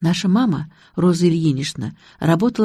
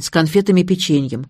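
plain reading voice

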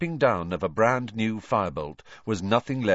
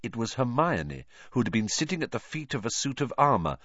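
usual speaking voice

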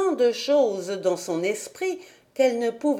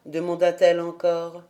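plain reading voice

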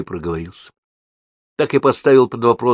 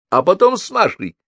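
high-pitched accusing follow-up; indignant mother as prosecutor; pronouncing an accusation; 11 semitones higher than usual. A narrator's reading. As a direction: press the charge in a raised pitch. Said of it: "выносила она обвинение".

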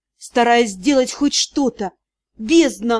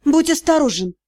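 plain reading voice

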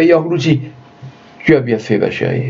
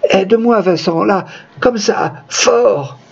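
pleading dying woman; 8 semitones higher, slower than usual. Acted. Dying woman begs for help.

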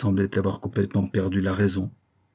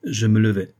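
plain reading voice

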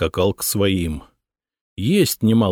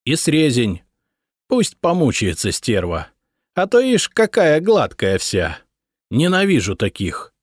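spiteful; 6 semitones higher than usual. A narrator's reading. Gudrun spiteful orders.

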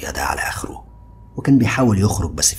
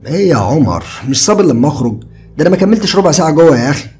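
higher, louder easygoing friend; carefree young man, higher and louder; Ziad's casual voice; 11 semitones higher, 9 dB louder than usual. A narrator's reading. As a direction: toss it off lightly like a carefree buddy. Ziad annoyed opening door.

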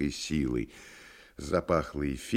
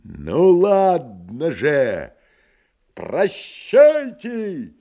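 desperate shout; 9 semitones higher, 8 dB louder, much slower than usual. Acted. Ivan lunging at window.

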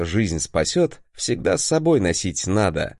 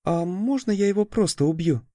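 ingratiating; 8 semitones higher than usual. Acted. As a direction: ask sweetly, fawning a little. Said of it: "как-то заискивающе спросила".

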